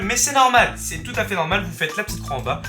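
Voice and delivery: ordinary talk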